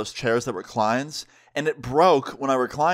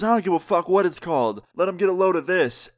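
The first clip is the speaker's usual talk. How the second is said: higher-pitched imitation of a tipsy mother; drunk mom; 7 semitones higher than usual. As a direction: lightly play the brazen drunk mom. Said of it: "She is absolutely hammered".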